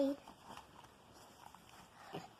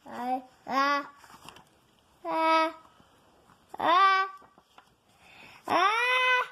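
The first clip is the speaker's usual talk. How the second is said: rising ah before sneeze; building sneeze; 5 dB louder, slower than usual. Acted.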